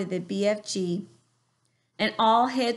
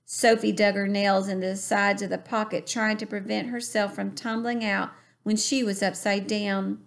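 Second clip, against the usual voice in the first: plain reading voice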